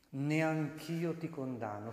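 very slow, measured quotation; solemn pardon. Acted. Preacher quotes Jesus to the woman, slow solemn pardon. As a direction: draw out each word of the pardon.

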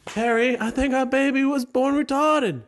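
very high falsetto spouse; hammed-up high-pitched parent; mock parent voice. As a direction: put on a squeaky falsetto parent. Acted parent speaking to spouse.

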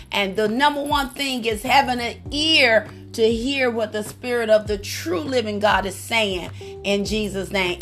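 ordinary talk

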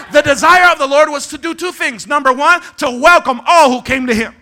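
loud, high, emphatic preaching; full-force preaching. Real, not acted. Preacher proclaiming the Lord's welcome, full-force preaching. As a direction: proclaim it to the whole house.

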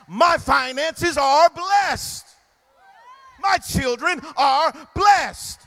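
slow, growing to loud shouts on each blessed; building declaration. Shown as a demonstration. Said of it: "Do it again".

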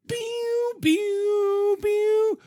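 high laser sound effects; pew pew laser sounds. Pretend. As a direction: fire off high pew pew zaps.

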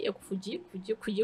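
fading to a near mumble; trailing off. Real, not acted. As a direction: let the words die away. Repeating confundi, fading out.

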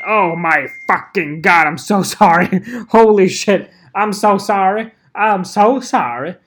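loud, flustered real apology; startled apology. Real, not acted. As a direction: blurt out a shocked sorry, laughing. Apologizing for a blooper.

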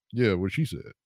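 soft, sheepish go-along; sheepish mumble. Retold or imitated. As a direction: mumble agreement like a caught man. Feeble agreeing while caught.

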